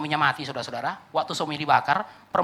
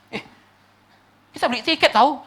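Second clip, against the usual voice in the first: higher-pitched indignant complaint; mock protest; 6 semitones higher, 3 dB louder than usual. Moviegoer to the man interrupting the film, mock protest. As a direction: object like a put-out paying customer. Annoyed moviegoer answering the shouter.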